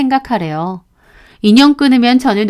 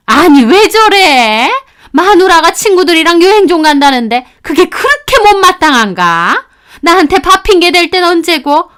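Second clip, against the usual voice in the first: put-on higher, fussing older woman; indignant mother-in-law; 8 semitones higher, 5 dB louder than usual. Mother-in-law venting about husband, indignant. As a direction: complain loudly to the room.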